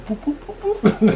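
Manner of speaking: quick high boops with a laugh; bouncy boop sounds. Retold or imitated. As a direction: mimic the bear hopping again. Imitating bear bounding again.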